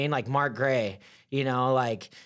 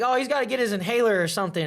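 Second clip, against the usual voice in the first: casual coach; 8 semitones higher, faster than usual. Retold or imitated. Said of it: "Kenny's like".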